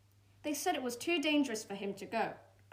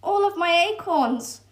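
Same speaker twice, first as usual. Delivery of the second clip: high, loud wail over lost acorns; upset squirrel lamenting; distraught cry; 9 semitones higher, 10 dB louder than usual. Acted. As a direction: squirrel crying out in despair. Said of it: "the squirrel cried".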